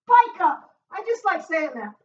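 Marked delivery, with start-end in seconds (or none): 0.1-0.7 loud, high exercise call; called-out cue. Real, not acted. Trainer calling the move to the class, loud call.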